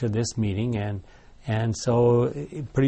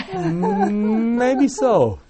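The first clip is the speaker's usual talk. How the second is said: high-pitched drawn-out "mm", amused; playful concession; 9 semitones higher, much slower than usual. Real, not acted. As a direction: concede the joke in a rising, high voice. Joking reply after the laughter.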